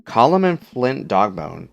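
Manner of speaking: ordinary delivery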